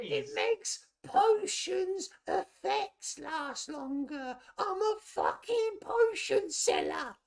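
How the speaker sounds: a cranky little goblin snapping back; exasperated goblin voice. Acted. Goblin defends his trade.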